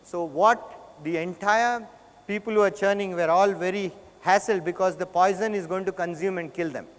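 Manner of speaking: ordinary talk, nothing marked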